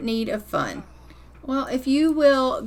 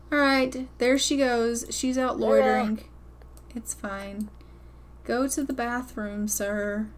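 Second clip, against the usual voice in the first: usual speaking voice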